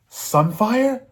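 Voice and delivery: high astonished question; startled at his dragon's growl; mock surprise. Aegon turning toward the growl behind him, high mock surprise.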